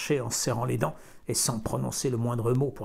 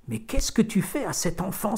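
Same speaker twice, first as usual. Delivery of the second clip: higher alarmed cry of protest; shocked cry; 7 semitones higher than usual. A narrator's reading. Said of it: "s'écria-t-elle".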